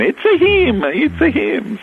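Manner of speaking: high-pitched, hammed-up Italian accent; very bad Italian accent. Retelling the parents' recognition, very bad Italian accent. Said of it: "in a very bad Italian accent".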